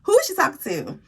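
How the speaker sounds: high, outraged who-does-she-think; indignant disbelief. Real, not acted. Vlogger retelling the roommate's rude demand, high outraged disbelief. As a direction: gasp it out, offended and high.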